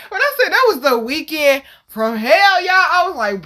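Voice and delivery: usual speaking voice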